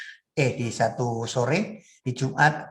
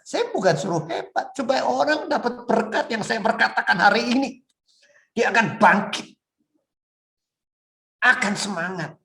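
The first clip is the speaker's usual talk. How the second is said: raised, high voice rallying the congregation; forceful preaching; 10 semitones higher, 5 dB louder than usual. Real, not acted. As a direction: build to a high, loud rallying cry. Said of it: "Bukan saya keras ngomongnya". (berkhotbah dengan suara keras dan tinggi)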